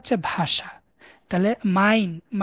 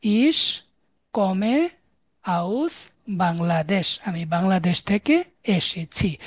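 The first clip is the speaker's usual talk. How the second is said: slow model answer; slower than usual. Shown as a demonstration. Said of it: "তাহলে আমি কিভাবে বলবো?".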